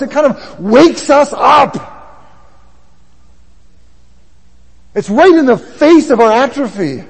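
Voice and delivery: loud, high, impassioned sermon; full-force preaching. Real, not acted.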